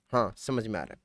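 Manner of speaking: low, soft reply as the class; playing the students answering; mock student reply.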